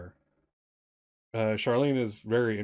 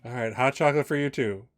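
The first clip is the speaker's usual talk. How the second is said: waitress voice; 2 semitones higher, 4 dB louder than usual. Acted.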